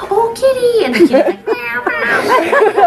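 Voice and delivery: high cooing then high meowing. Retold or imitated. Person cooing, cat meowing back cutely. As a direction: coo at the kitty, then meow back high. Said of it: "you're like".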